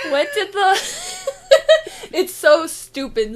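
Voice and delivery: giggling through the words; can't stop laughing at own joke. Real, not acted. Cracking up before joke.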